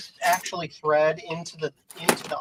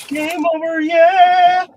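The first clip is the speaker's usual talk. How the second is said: high, loud triumphant yell; excited shout; 14 dB louder, much slower than usual. Real, not acted. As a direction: cheer it out loudly. Mock celebration after mistake.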